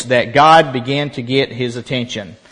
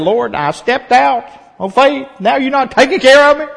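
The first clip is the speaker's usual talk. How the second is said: high, whiny plea to God; a self-pitying complaint, pitched up; complaining prayer; 10 semitones higher than usual. Retold or imitated. Preacher retelling his prayer to God, high and whining. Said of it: "I was saying".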